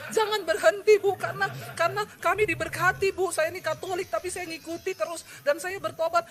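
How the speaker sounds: high, sustained mock-weepy thanks; imitated tearful caller. Weeping caller thanking the preacher for her sermons, imitated tearfully. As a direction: imitate a grateful caller crying down the phone. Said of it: "ada yang telepon nangis-nangis".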